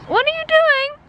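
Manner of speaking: very high coo. Real, not acted. Asking her sniffing dog in high baby talk. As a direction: ask the dog sweetly. Talking to the dogs.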